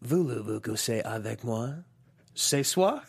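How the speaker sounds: slow, seductive French line; suave villain. Acted. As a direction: purr it like a movie villain. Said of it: "You can't keep a straight face".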